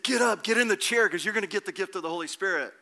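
forceful retold call to get up; acted urgent command. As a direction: order a friend up with forceful urgency. Retells bursting in, ordering friend up.

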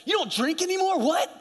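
high-pitched mock disbelief; incredulous friends. Old friends questioning the new believer, mock incredulous. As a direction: imitate baffled friends, voice way up. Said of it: "All your friends".

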